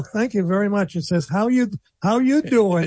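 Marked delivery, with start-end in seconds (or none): 1.3-2.9 put-on reading in Jersey accent. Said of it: "lost his accent entirely".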